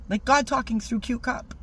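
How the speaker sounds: ordinary delivery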